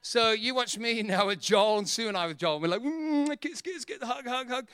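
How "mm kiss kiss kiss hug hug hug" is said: high-pitched, soft cooing; playfully smother them with kisses and hugs; hammed-up doting baby talk; gushing affection.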